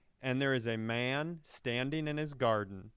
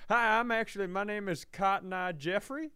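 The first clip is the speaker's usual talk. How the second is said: mock Southern doctor; 5 semitones higher than usual. Doctor introduces himself as Cotton Eye Jeffrey.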